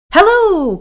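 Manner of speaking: high, loud brave call; yelling hello. Acted. Mouse yelling at the dark shape in the tree, high and loud. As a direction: shout it up into the tree. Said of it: "Mouse yelled at the dark shape".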